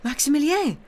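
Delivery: high, loud joyful exclamation; cry of joy. A narrator's reading. Sister seeing her brother has returned, cry of joy. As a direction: cry out in delighted surprise. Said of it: "gave a cry of joy".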